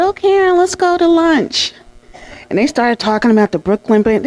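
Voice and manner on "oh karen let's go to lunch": much higher, sugary inviting voice; high mock-sweet colleague voice; sweet coaxing invitation. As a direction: lure her in with a honeyed invite.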